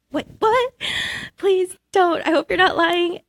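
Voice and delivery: can't believe the news, beg it to be true; stunned disbelief. Retold or imitated. Disbelief on hearing she won.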